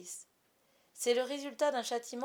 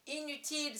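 exclaiming; 6 semitones higher than usual. A narrator's reading. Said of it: "s'écria-t-il".

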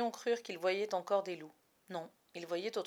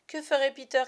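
loud, high frightened chorus; frightened cry; 6 semitones higher, 9 dB louder than usual. A narrator's reading. Frightened boys cry to each other, loud. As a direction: call out in fright. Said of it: "cria le chœur des garçons affolés".

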